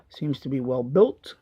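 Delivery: ordinary talk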